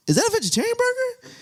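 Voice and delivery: squeaky high disbelieving voice; high surprised question. Retold or imitated. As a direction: ask it in a shocked high voice. Spotting the vegetarian burger.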